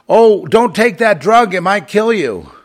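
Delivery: high, slow, loud mock alarm; mocking doctor voice. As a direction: parody the doctor's sudden panic.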